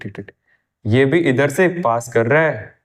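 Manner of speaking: ordinary talk, nothing marked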